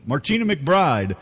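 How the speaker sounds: usual speaking voice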